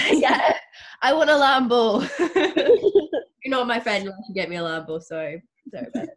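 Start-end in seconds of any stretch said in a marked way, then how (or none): 0.1-4.0 loud, high mock demand through laughter; playfully greedy, laughing shout; joking demand. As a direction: call it out while cracking up. Joking demand among laughing friends.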